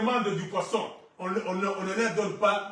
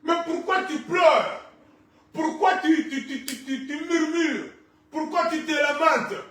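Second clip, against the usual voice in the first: high-pitched, challenging appeal to the flock; rousing pulpit challenge; impassioned preaching; 8 semitones higher, 6 dB louder than usual. Real, not acted. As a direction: cry out the questions like a fired-up preacher. Preaches at full force.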